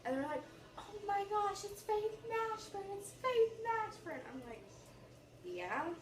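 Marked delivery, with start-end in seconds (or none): 0.8-4.2 high, slow, starstruck gushing; fangirling. Retold or imitated. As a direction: squeal the name like a fan.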